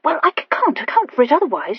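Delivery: high, loud, halting question; stammering Horatia, puzzled. Acted. Horatia in dialogue.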